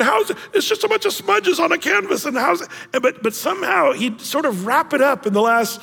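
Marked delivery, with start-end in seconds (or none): 0.0-2.7 baffled. Retold or imitated. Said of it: "you just sit there going".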